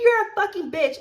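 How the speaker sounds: high, loud retort; acted angry insult. Server re-enacts coworker repeating an insult, angrily.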